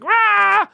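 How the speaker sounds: a sudden high yell of frustration; frustrated high roar. Retold or imitated. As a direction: let out a cartoonish angry roar. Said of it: "And I was like".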